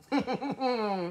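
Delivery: soft closed chuckle. Real, not acted. Streamer about to start the show, soft chuckle. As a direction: chuckle softly to yourself.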